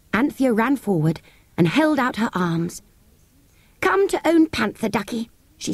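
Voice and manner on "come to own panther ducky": put-on high, cooing coax to a baby. A narrator's reading. Anthea calls the baby to her, coaxing. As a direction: coax the little one in a high voice.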